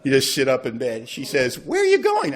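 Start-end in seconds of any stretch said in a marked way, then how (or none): 1.6-2.4 high, sharp mock question from the wife; startled wife.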